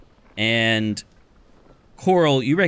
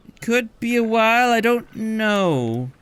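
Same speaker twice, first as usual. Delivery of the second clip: much higher, slow and noncommittal; evasive barkeep; 10 semitones higher, slower than usual. Acted. Barkeep dodges how long the blockage lasts, high slow voice. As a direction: stall vaguely.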